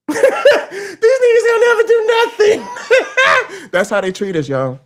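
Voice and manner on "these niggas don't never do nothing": laughing. Retold or imitated. Billionaires cackling at the public.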